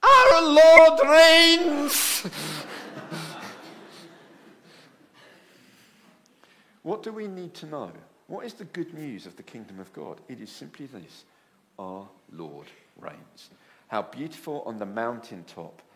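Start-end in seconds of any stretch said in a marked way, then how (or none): 0.0-2.3 very slow, high and much louder, proclaiming; old lady in the bath hoist proclaims it to Steve; old lady's proclamation. Retold or imitated. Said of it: "And she said". 2.3-4.5 soft laugh at the punchline; a quiet chuckle after the story. Real, not acted. 11.8-13.5 slow, soft and much lower proclamation; preacher quietly sums up the good news; solemn quiet. Acted. Sermon restates the lady's cry.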